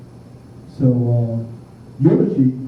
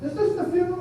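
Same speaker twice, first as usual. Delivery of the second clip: high, unsure question at the door; a guest checking he has the right funeral, asking high; puzzled mourner; 5 dB quieter, faster than usual. Retold or imitated. Mourner asking the priest, puzzled and high.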